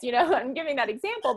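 ordinary delivery

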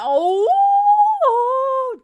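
long, high, loud "oh!"; excited whoop. Real, not acted. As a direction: whoop loudly as drama kicks off. Narrator hyping the coming showdown.

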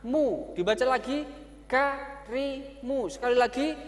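lightly voiced teacher to pupil; teacher drilling pupil. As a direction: coax and repeat with the child. Teacher drills child garimu.

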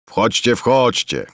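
slow, drawn-out inviting call; mild call. A narrator's reading. Moneylender calls the monk into the wagon, slow call. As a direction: beckon them in, unhurried. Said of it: "krzyknął tłusty lichwiarz".

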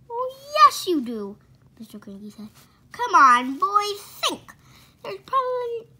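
high, loud insistence; insistent. Acted. Father pressing the boys to remember, loud. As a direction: press them hard to think.

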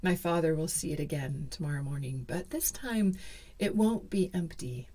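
ordinary delivery

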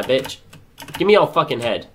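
usual speaking voice

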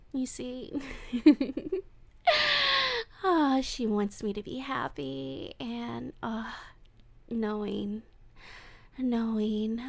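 creepy lovesick voice, drifting into breathy whispers; obsessive captor. Acted. Captor confesses love to tied-up Wolfie, creepy and hushed. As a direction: confess your obsession to your captive, unhinged.

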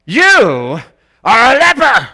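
high, loud accusing voice; condemning accusation. Retold or imitated. Condemnation personified, loud accusing declaration. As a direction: spit out the verdict. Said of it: "Condemnation is".

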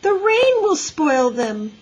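high, slow, whining plea; protesting. A narrator's reading. Little girl fears rain spoiling boots, protesting. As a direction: drag it out like an upset child. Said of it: "protested Ramona".

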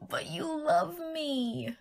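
high, slow, drawn-out pout; wheedling. Pretend. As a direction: plead with wounded, mock-hurt insistence. Wheedling partner.

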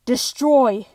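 loud, commanding shout; booming villain command; destroy take. Shown as a demonstration. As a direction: bark the order as a villain. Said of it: "I'll give you a couple destroy takes".

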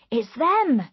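high, loud excited cry. A narrator's reading. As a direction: call out the news excitedly. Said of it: "said Richards excitedly".

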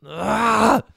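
loud, high yell of frustration; frustrated growled cry. Acted. Girl throwing off her covers, frustrated yell. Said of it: "she growled as she threw her covers off".

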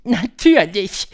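high, slow, drawn-out witch voice; high-pitched, deliberate witch lecturer; Grand High Witch. A narrator's reading. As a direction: spell out the recipe slowly in a high witch voice.

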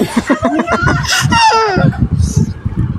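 high shouted laugh; screaming laugh. Real, not acted. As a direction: shriek with laughter. Laughing fit during challenge. (sigaw na tawa)

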